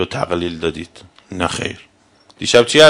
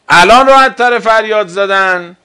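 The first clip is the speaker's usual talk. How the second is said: 1 raised voice; 9 semitones higher, 5 dB louder than usual. Real, not acted. Rhetorical challenge to the audience.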